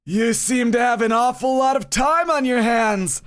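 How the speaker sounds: high, slow smug taunt. Acted. Rival taunting the champion in a smug voice. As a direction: sneer the word champion. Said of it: "That smug voice".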